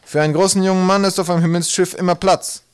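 loud, high sky-pirate voice; hearty pirate invitation. A narrator's reading. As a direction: pitch the offer loudly across the deck. Pirate inviting youth aboard ship.

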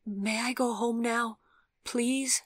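put-on high, slow, timid child's plea; shocked, frightened boy. A narrator's reading. Shaken boy asks an adult to let him go home, fearful. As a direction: plead like a scared little boy. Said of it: "His voice shook with shock and fear".

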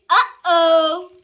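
loud, dismayed uh-oh. Acted. Reader realizing the book tricks him, loud. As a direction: cry out uh-oh in alarm.

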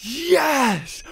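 high-pitched, drawn-out cheer; elated cheer. Real, not acted. Player celebrating the restocked chest, elated cheer. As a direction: let out a delighted victory cry.

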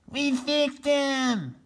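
high, very slow gloating cry; triumphant shout. Acted. Solomon telling the turkeys they won, triumphant. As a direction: savour the victory. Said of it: "he shouted".

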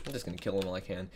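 ordinary talk

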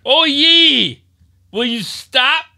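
high, loud scolding grandma. Pretend. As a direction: yell like a nagging grandma.

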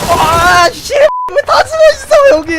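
high, slow, shocked shout; startled yell. Real, not acted. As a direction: scream out in real shock. Ambushed by hiding enemies.

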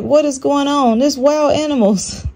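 high-pitched bewilderment; astonished, pitch held high; retold alarm. Retelling alarm at wild animals.

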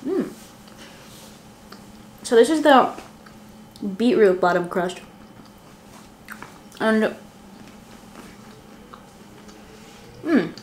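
talking while chewing; mouth full of pizza. Real, not acted. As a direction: between bites of food. Seen 0: Bites before speaking, chews while showing crust, bites again.

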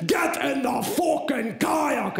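hammed-up instructor shouting; shouting guide. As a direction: bark orders at the scared father and son. Guide Graham yelling at them.

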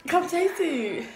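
loud high call to taste. Real, not acted. Calling sister to taste.

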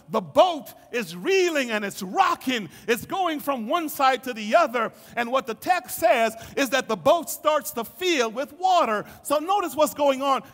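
preaching, steadily rising in force and pitch; building sermon. Acted. Preacher telling the congregation of a sinking boat, building intensity. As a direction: drive the story toward a shout.

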